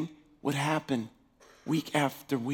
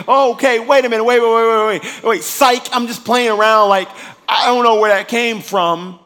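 panicked backpedal; 7 semitones higher, 14 dB louder than usual. Retold or imitated. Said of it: "wish you could just take back".

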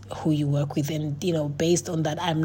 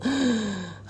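a quick, startled intake of breath, pitched up; mock gasp; 5 semitones higher than usual. Mimicking people who gasp at faith in business. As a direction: gasp as if shocked by the idea. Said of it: "people go".

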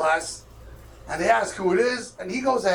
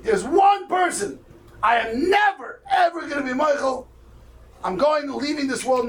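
retold vow, pitched up and hammered out; vehement vow; 7 semitones higher than usual. Dying father swearing to his children he won't forgive, vehement.

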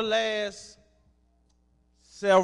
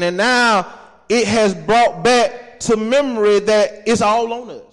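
loud, higher, fervent preaching; preaching at full force; 6 semitones higher, 12 dB louder than usual. Acted. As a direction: preach it hard to the congregation.

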